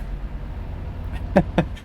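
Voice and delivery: soft, breathy chuckle; laugh. Real, not acted. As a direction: chuckle quietly at your own struggle. Laughing at his own struggle.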